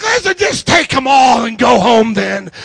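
loud mock exasperation; hammed-up exasperated yell; fed-up outburst. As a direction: shout it as giving up on an argument. Acted giving up in argument.

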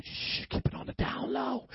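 shush, then whisper it; very soft, voiceless confidential warning; hushed secretive whisper. Acted. Jesus telling demons not to tell anybody, secretive whisper.

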